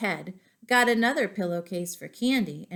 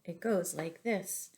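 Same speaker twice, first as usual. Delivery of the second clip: ordinary delivery, nothing marked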